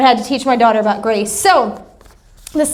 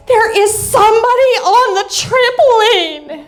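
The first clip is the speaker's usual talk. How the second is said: retold yell; 8 semitones higher than usual. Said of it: "And Abby yells".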